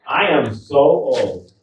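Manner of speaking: loud, slow, drawn-out boast; call-and-response setup. Acted. Comic cues audience to shout back, slow loud declaration. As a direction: announce it big and slow for the crowd.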